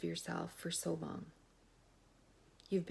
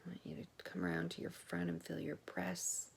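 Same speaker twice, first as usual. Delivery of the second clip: soft, slow meditation voice, lingering on each part; guided body scan; 5 dB quieter than usual. Real, not acted. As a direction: lead listeners quietly through the body, pausing between phrases.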